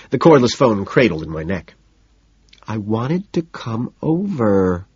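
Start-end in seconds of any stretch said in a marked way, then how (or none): 2.7-4.9 put-on slow, whiny little-girl voice. A narrator's reading. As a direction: whine it like a sulky little girl. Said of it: "whiny little girl tone".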